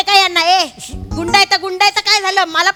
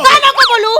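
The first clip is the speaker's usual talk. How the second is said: loud, high challenge; defiant protest; 7 semitones higher, 5 dB louder than usual. Acted. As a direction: demand an answer, voice raised. Defies being silenced.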